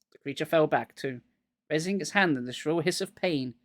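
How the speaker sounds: plain reading voice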